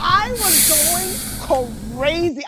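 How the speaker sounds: drawn-out, hushed high voice; stunned disbelief. Retold or imitated. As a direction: stretch every word in mock shock. Retelling stunned reaction to the news.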